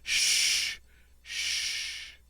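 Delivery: a hushed breathy sh, voiceless; the French ch said as sh; sh sound. Shown as a demonstration. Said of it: "like the S H in shout".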